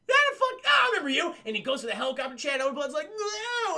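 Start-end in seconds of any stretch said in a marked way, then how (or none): 0.1-1.4 high, loud gleeful croc voice; menacing croc. Retold or imitated. 3.1-3.8 high frightened cry; panicked no. Retold or imitated.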